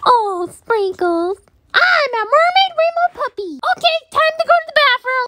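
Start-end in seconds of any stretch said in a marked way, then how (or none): 1.7-5.3 high, quick cartoon voice; eager high-pitched character; excited toy puppy. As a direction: announce it like a thrilled little puppy.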